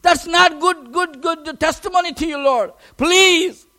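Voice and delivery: ordinary talk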